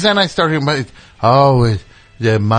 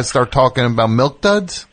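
ordinary talk, nothing marked

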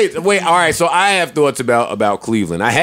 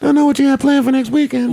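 put-on girlfriend voice, pitched higher; lightly put-on woman voice; man doing a woman's voice; 9 semitones higher than usual. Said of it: "why is your woman voice the same".

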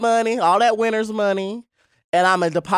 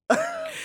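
shrieking laugh; 5 dB quieter than usual. Real, not acted.

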